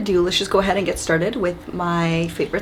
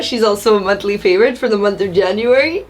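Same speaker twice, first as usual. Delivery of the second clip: ordinary talk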